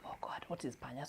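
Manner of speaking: panicked whisper; whispered to herself. Retold or imitated. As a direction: hush it like a secret worry. Frantic inner thought, unvoiced.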